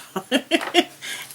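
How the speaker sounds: breathy high giggle. Real, not acted. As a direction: let a high laugh spill out. Laughs after telling owner.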